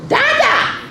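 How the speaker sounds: very high squawked call; high-pitched, insistent animal parent; duck parent. A narrator's reading. Duck parent prompting its baby.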